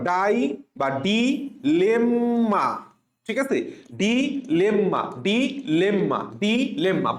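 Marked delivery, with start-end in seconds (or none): 1.0-2.6 ডিলেম্মা stretched into slow, separate syllables; syllable-by-syllable demo. Shown as a demonstration. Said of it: "এইভাবে পড়বা". 4.0-7.1 ডিলেম্মা chanted slowly as "di, lemma"; repeat-after-me drill. Shown as a demonstration. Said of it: "আমার সাথে বলো".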